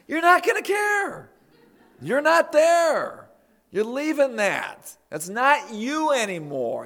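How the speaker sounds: preaching shout. Acted. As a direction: shout it down at their fear. Preacher rebukes worried listeners.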